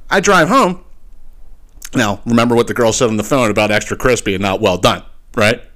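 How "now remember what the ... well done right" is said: ordinary delivery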